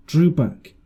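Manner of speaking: plain reading voice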